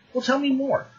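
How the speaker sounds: high-pitched, eager prodding; prying players. Acted. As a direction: imitate players pressing for details. Players press the demon for news.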